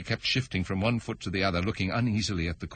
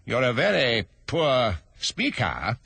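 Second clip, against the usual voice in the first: king voice; 4 semitones higher, slower than usual. Acted.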